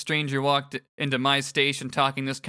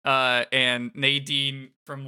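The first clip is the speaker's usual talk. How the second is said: normal talking voice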